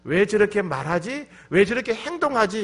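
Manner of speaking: high, loud exasperated questions; complaining, blaming. Acted. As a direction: complain as if you can't understand them. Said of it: "이렇게 불평하고 원망은 하되".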